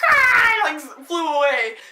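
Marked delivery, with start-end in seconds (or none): 0.1-0.7 loud, high imitated seagull cry; harsh mock bird squawk; bird screech. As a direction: screech like an attacking gull. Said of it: "and then it went".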